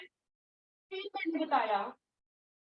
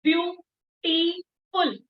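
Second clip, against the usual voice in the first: Beautiful very slowly as view, टी, फुल with pauses; louder, drawn-out beautiful in three broken pieces; halting syllables; 3 semitones higher, 5 dB louder, much slower than usual. Shown as a demonstration. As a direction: stop after each syllable of beautiful. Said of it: "रुक रुक के आ रही है आवाज".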